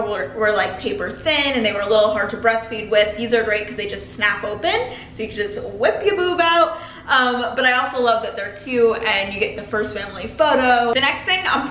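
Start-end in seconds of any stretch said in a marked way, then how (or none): 5.1-6.8 laughing through the words; giggling at her own joke. Real, not acted. Joking about nursing gowns.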